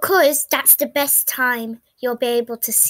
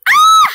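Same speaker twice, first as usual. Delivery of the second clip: scream; 13 dB louder than usual. Pretend. Scream in roller coaster ride.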